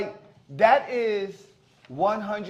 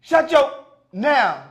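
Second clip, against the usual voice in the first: mock order; 7 semitones higher, 9 dB louder, much slower than usual. Acting out a couple's quarrel.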